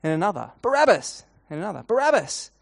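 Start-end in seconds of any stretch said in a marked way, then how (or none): 0.6-1.2 high, loud yell of the name; crying out. Acted. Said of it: "someone else cries out". 1.8-2.5 high, loud yell of the name; crying out. Acted. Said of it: "someone else cries out".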